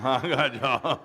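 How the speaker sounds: playful laughing jab. Real, not acted. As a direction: laugh it out at the crowd.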